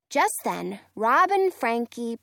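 ordinary delivery, nothing marked

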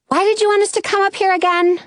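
high, rushed exasperated question; frustrated. A narrator's reading. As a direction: demand a reason in irritation.

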